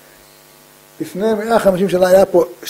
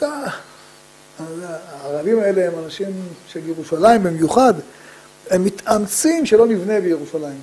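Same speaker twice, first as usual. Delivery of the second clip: usual speaking voice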